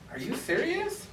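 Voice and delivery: high disbelieving question; incredulous. Retold or imitated. Retelling the owner's excuse, high incredulous squeak. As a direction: act floored by the excuse.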